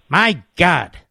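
ordinary talk

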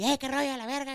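high, rowdy mock shout of a local buddy; mock rowdy northern-Mexico greeting; imitating local loud greeting. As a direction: toss off a brash high-pitched hey man greeting. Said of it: "y grite, y grite aquí".

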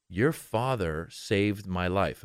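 slow, solemn retelling; slow earnest quote. Retold or imitated. Comedian quoting old man slowly and solemnly. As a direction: let it land slowly. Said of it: "And he goes".

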